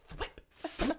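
thwip said as one quick soft word; thwip pronounced. Shown as a demonstration. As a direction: say thwip softly like the comic sound. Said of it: "it's spelled T H W I P".

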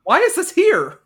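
high-pitched, baffled outburst; incredulous protest. Acted. Cohost questioning a bizarre film scene, incredulous. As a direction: demand an explanation in disbelief. Mock outrage at odd scene.

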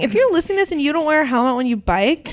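high, exasperated scolding, rising to a yell; yelling at kids. Retold or imitated. Yelling at helmetless kids on bikes, exasperated and angry. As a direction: scold careless bikers like an angry parent. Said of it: "I had a physical reaction".

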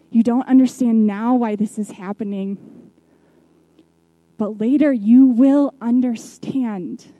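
preacher driving Jesus' words home; building emphasis. Acted.

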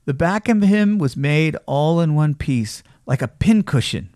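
ordinary delivery

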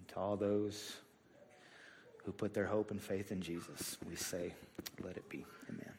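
soft, low public prayer; hushed pastoral prayer, voice sinking toward the amen. Real, not acted. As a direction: pray quietly and sincerely, pausing between phrases. Leads congregation in prayer.

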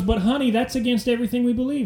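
hammed-up wifely protest. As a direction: plead as a mock wife, voice lifted. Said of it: "the wife goes".

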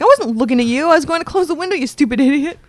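high-pitched mock indignation; hammed-up annoyed comeback; indignant retort. Retelling an indignant retort to neighbour.

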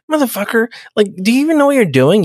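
high, fast mock rant at the tech; hammed-up exasperated yelling; mock angry outburst. Crew member ranting at Lynch, high fast mock anger.

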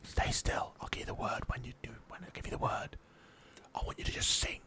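hushed, voiceless stage whisper; conspiratorial whisper. Acted. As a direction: whisper secret orders to the animals. Secretly directing animals to perform.

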